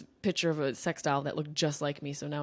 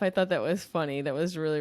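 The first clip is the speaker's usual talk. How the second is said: usual speaking voice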